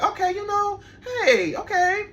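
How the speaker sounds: high, crying mock okay; mock whimper. Giving in to the eager client, a mock crying okay.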